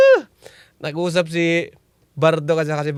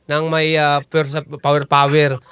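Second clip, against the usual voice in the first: ordinary delivery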